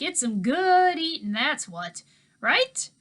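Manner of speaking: put-on easygoing old man talking to kids; Mookie's voice. A narrator's reading.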